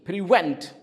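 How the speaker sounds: "Prevent" with a loud, falling VENT; stress on second syllable. Shown as a demonstration. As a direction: model "Prevent" for repetition, punching the second syllable. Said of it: "stress is on the second syllable".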